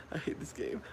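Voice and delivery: whispered, high and breathless; scared whisper trailing off; frightened whisper. Real, not acted. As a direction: whisper it in fear. Repeated while terrified.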